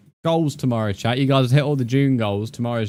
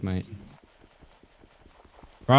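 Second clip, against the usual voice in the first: normal talking voice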